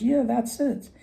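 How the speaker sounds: greedy woodsman. Retold or imitated. Greedy woodsman lies to the elf, high and eager.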